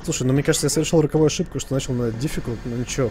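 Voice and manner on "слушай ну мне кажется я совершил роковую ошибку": ordinary talk, nothing marked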